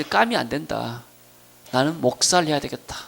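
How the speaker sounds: ordinary talk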